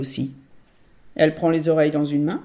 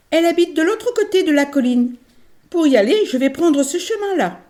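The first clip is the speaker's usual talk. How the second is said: put-on higher, louder child's voice; little girl; 11 semitones higher, 6 dB louder than usual. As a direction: explain eagerly like a little girl. La fillette explique. (voix de fillette enjouée)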